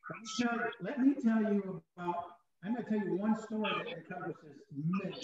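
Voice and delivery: muffled, far from the mic; underwater gurgling voice. Real, not acted. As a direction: voice lost underwater. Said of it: "You sound like you're underwater".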